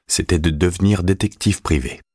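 ordinary delivery

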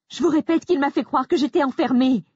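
high, hysterical insistence. Acted. Grace insisting to the manager, hysterical. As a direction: insist on the edge of panic. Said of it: "mes intonations hystériques".

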